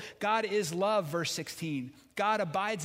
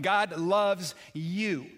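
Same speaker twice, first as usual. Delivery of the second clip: slow, loud, every word landed; "God loves you." declared with full weight; exclamation point; 5 dB louder, much slower than usual. Shown as a demonstration. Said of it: "This is an exclamation point".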